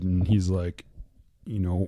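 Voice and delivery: ordinary delivery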